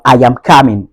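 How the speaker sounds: COMING as "KAH-min" in a Ghanaian accent. Shown as a demonstration. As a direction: repeat it Ghanaian style, open "ah" in coming. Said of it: "du côté ghanéen".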